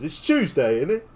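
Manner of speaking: mock British Knuckles, rising high at the end; lightly done British accent; British accent impression. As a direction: toss off a British line. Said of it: "whip out a British accent".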